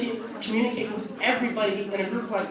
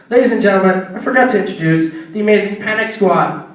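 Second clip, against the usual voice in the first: announcer call; 4 semitones lower, 10 dB louder than usual. Real, not acted. Announcing the band to the crowd.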